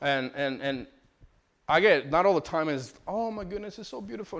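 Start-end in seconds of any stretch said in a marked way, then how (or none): 3.1-4.4 soft, high mock gushing. Father mocking the idea it's always beautiful, gushing. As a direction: coo it softly, as if all is lovely.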